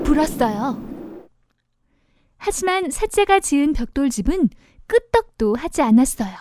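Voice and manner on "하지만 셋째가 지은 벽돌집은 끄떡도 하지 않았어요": ordinary delivery, nothing marked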